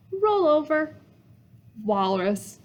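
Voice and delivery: ordinary delivery, nothing marked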